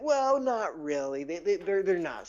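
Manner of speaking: high, drawn-out hedging; doubtful correction. Acted. Gnome correcting his boastful friend, high doubtful voice. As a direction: stretch it up high, then stumble.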